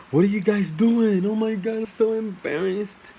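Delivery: high, loud mock-girly cry, slowing at the end; voicing the girl. The girl bursting into the courtroom, high loud mock shriek.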